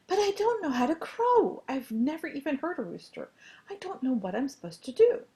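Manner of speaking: high, fretful outburst; worried young rooster. A narrator's reading. Young rooster confessing he can't crow, worried and high.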